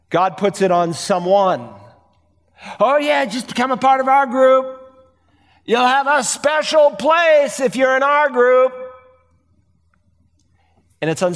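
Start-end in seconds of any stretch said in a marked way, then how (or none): 2.8-8.7 higher, sugary salesman voice; lightly mocking come-on; mock cult recruiter. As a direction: mimic a door-to-door recruiter luring you in. Mimicking a cult door-knocker.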